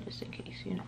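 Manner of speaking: whispered aside. Real, not acted. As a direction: drop to a whisper for the little aside. Whispered aside while blending.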